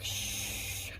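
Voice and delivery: soft unvoiced shush; hushing. Real, not acted. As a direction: shush to keep quiet. Hushing at work.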